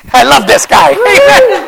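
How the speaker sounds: ordinary talk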